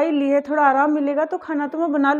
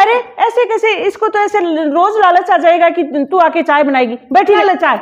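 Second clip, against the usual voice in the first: indignant; 3 semitones higher, 6 dB louder than usual. Acted. Mother-in-law snaps at the elder daughter-in-law in the younger one's defence, loud and indignant. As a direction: insist the younger one must not work.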